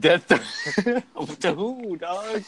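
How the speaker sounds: laughing disbelief. Real, not acted. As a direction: laugh through the question.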